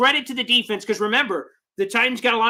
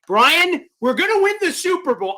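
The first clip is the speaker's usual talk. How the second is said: loud, high, jubilant call; excited proclamation; 7 semitones higher, 6 dB louder than usual. Real, not acted. Host reads Brian's Super Bowl prediction, excited. Excitedly echoes fan's bold claim.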